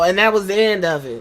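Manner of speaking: ordinary talk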